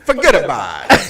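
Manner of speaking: put-on playful Italian-American mobster accent; mock Italian-American wiseguy; movie Italian-American accent. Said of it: "doing the accent like they do in the movies".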